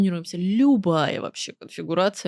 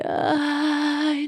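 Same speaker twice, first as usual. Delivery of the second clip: breathy; 6 semitones higher than usual. Shown as a demonstration. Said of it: "на придыхательное".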